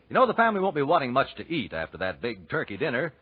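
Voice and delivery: normal talking voice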